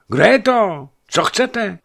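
loud, high, sleepy and annoyed; irritated. Acted. Woken merchant asks the messenger, irritated and loud. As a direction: snap out of sleep at the intruder. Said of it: "zeptal se podrážděně".

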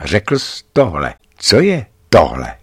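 usual speaking voice

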